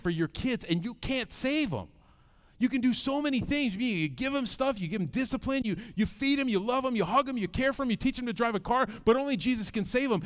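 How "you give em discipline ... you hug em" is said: normal talking voice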